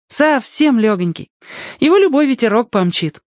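put-on high-pitched boy's voice; lively boy. Boy explains the winds to his new friend, high boyish voice. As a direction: explain it eagerly, pitched well up.

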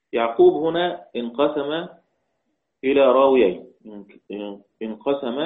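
ordinary talk, nothing marked

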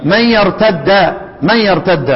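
يرتد with a held double d: yartadda; idgham. Shown as a demonstration. Teacher gives the other readers' version, يرتد as yartadda. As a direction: merge the dals in يرتد and end on "a". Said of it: "يقرؤون ايه؟".

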